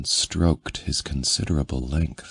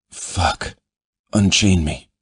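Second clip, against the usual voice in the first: urgent, louder demand; 3 semitones higher, 3 dB louder than usual. A narrator's reading. Man demanding release.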